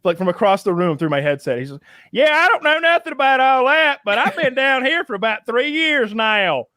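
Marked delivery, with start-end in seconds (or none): 2.1-6.7 high, exaggerated Southern accent; very strong Southern accent. Retelling a guy heard across the room, thick Southern accent. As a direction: imitate a thick Southern accent, drawing out the end. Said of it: "the strongest Southern accent I had heard".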